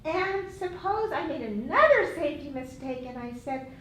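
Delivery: usual speaking voice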